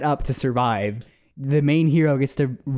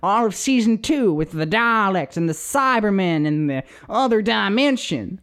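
put-on Southern accent; 7 semitones higher than usual. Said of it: "I can't do the Southern accent anymore".

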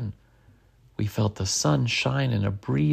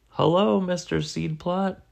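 shouting; 6 semitones higher than usual. A narrator's reading. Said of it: "we all shouted".

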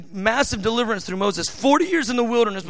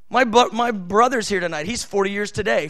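ordinary talk, nothing marked

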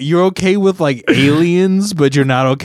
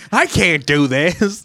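slow, higher put-on whine; John Candy character; 4 semitones higher, slower than usual. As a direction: mimic a helpless eighties comedy character. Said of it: "talk like a John Candy character".

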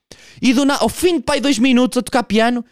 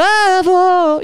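very slow, higher and louder lament; mock wailing singer; 10 semitones higher, 4 dB louder, much slower than usual. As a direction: stretch it out like a sobbing ballad. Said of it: "começa a mudar". (lamento arrastado e exagerado)

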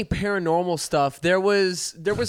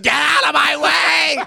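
shouting; 10 semitones higher, 4 dB louder, slower than usual. Pretend. Celebrities fleeing fire, yelling.

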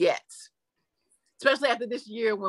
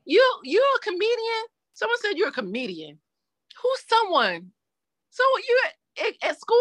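put-on high, questioning little kid voice; imitating young daughter; 13 semitones higher than usual. Daughter questioning mom about being a comedian, imitated child voice. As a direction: grill her like a puzzled child, pitched up. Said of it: "she's like".

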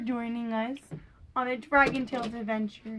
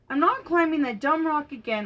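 raised, higher voice of refusal; louder acted protest; mild storybook yell; 4 semitones higher, 5 dB louder than usual. A narrator's reading. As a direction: yell it lightly, in mock anger. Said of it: "Ord yelled".

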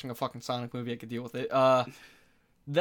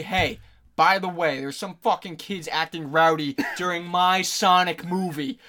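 louder, drawn-out put-on complaint; mock indignant complaint; 4 semitones higher, 9 dB louder than usual. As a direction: play a fussy moviegoer complaining to staff. Acting out complaint to an usher.

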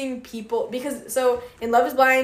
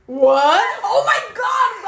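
loud, high, drawn-out cry of disbelief; excited outburst; 9 semitones higher, 6 dB louder, much slower than usual. Real, not acted. Friend recognizes the farmer dating show, excited outburst. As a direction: shriek it, laughing with sheer recognition.

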